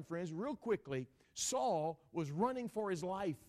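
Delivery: driving sermon voice, punched key words; forceful preaching. Real, not acted. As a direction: preach it out with mounting energy.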